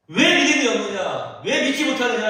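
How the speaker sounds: Jesus challenging the weeping Mary, loud and sharp; pointed rebuke. Retold or imitated. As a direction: call her out sharply for crying. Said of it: "라고 말씀하시는 거 아닙니까".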